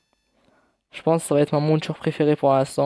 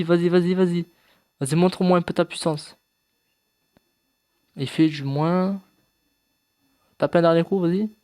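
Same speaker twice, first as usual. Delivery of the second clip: cheering on; 3 semitones higher than usual. Real, not acted. Cheering his creature in a fight.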